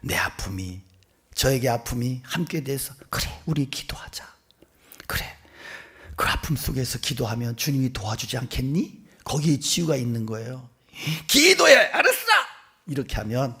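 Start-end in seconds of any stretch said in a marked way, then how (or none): 3.1-9.0 gentle comforting. Acted. Gentle invitation to pray together. 11.3-12.2 high, mock-bossy shout; harsh order. Mimics harsh order to pray.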